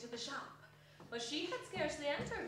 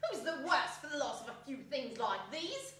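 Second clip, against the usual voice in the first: brazen charwoman; 7 dB louder than usual. Acted. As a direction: sneer at the dead man. Charwoman dismisses the dead man.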